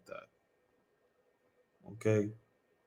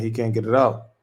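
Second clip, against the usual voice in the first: ordinary talk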